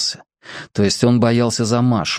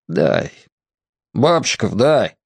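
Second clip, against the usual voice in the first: gloomy order; slower than usual. A narrator's reading. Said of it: "угрюмо велел капитан".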